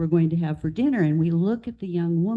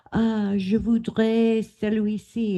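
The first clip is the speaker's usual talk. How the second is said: a careful, halting try at French, je voudrais celui-ci; attempted French; 5 semitones higher than usual. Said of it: "I'm gonna try to do French".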